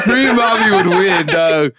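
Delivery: high, loud excited shout; hyped. Real, not acted. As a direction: get pumped, voice up. Hyping up free mommy.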